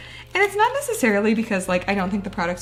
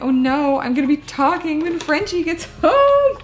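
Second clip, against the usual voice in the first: mock panic; 6 semitones higher, 6 dB louder than usual. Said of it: "And now I'm like".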